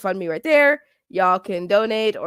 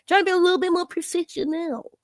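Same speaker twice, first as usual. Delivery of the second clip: higher, hurried, mock-professional voice; 8 semitones higher, faster than usual. Streamer explaining the new layout, playfully mock-professional. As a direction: play at sounding professional.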